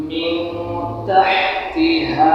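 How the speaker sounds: very slow "মিন তাহতিহা", the ন a nasal hum; nasal ghunna recitation. Shown as a demonstration. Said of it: "তাহলে উচ্চারণ হবে".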